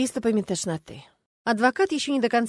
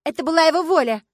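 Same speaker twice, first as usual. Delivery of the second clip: high, forceful protest; loud insistence; 9 semitones higher, 10 dB louder than usual. A narrator's reading. Starkova defending the cheap burial, loudly indignant. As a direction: insist at the top of the voice.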